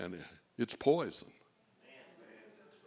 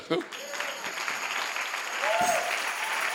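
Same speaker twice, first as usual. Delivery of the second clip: laughing. Real, not acted. After the hallelujah.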